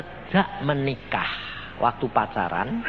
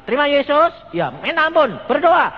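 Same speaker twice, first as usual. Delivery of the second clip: higher and louder, pressing him to pray; emphatic urging; 12 semitones higher, 8 dB louder than usual. Retold or imitated. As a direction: push the plea hard and high. Preacher urging sick man to pray. (desakan keras dan tinggi)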